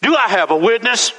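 high, ringing call to the church; preacher's call. Real, not acted. Preacher asking the congregation for a witness, ringing and high. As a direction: lift it up, ask for a witness.

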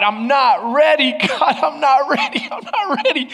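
rising, laughing plea to God; mock pleading.

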